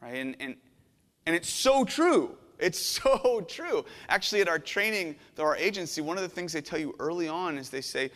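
ordinary delivery

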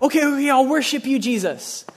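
high, rushed mock pleading; mock hasty worship. Everyone to Jesus on his return, mock hasty worship. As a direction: imitate people scrambling to worship in time. Mimics panicked last-minute worshipers.

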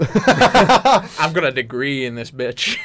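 slow, cocky pickup line; hammed-up seductive boast at half pace; mock chat-up line. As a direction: drag it out as a swaggering flirt. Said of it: "That's one hell of a chat up line".

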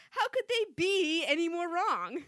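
slow, loud, mock-indignant question; Chandler-style sarcasm. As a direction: lean on it like a sitcom zinger. Sarcastic Friends quote, slowed.

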